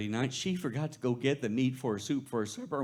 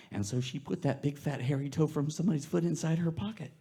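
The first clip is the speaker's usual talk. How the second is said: usual speaking voice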